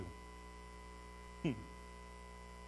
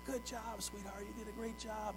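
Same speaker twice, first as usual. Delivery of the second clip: put-on soft, higher, gentle praise to a toddler; tender praise; 6 semitones higher, 10 dB quieter than usual. Father praising his little daughter, soft and tender. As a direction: coo it like praising a little girl.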